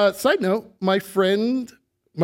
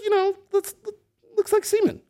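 high embarrassed aside. Real, not acted.

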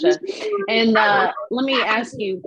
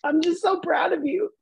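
higher-pitched, heartfelt praise; real choked-up affection; tearful pride; 6 semitones higher than usual. Real, not acted. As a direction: tell a friend through welling tears. Tearful praise to her friend.

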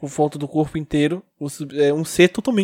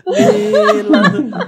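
laughing; 7 dB louder, much slower than usual. Real, not acted. Laughing mid-scene.

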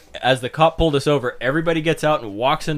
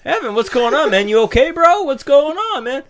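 high, raised call to a friend; calling out to a buddy, concerned; calling out to Evan; 8 semitones higher than usual. Retold or imitated.

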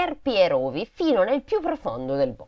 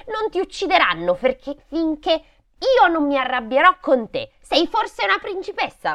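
put-on high child's voice; imperious little girl; 10 semitones higher, 5 dB louder than usual. A narrator's reading. Brigand's daughter grabbing Gerda by the waist, imperious. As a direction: pitch it up as a bossy girl.

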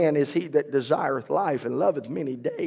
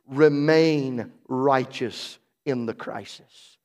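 ordinary talk

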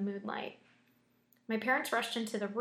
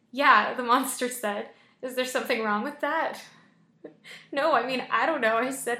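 loud excited reading; 3 semitones higher, 8 dB louder than usual. Acted.

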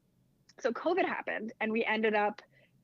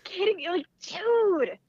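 rising, pitched-up mock exasperation; exasperated; 6 semitones higher, 6 dB louder, slower than usual. Narrator snapping back at the upselling clerk, exasperated. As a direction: throw your hands up, you can't believe this guy. Retelling her exasperated outburst.

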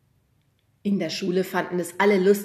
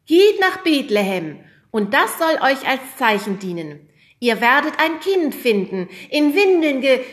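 proclaiming angel; 8 semitones higher, 10 dB louder than usual. Acted. Hanna plays angel proclaiming.

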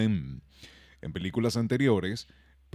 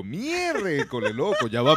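dismayed; 6 semitones higher, 4 dB louder, slower than usual. Retold or imitated. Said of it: "Y yo".

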